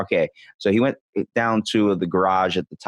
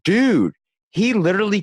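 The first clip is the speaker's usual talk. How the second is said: excited; 10 semitones higher, 4 dB louder than usual. Real, not acted. Excited about the spider video.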